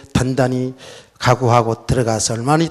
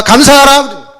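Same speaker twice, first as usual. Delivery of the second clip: a loud, high commanding "감사하라"; the voice of God, louder, commanding thanks; 10 semitones higher, 9 dB louder, slower than usual. Retold or imitated. Pastor voicing God's command, loud and high. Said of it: "하나님의 음성이 들려옵니다".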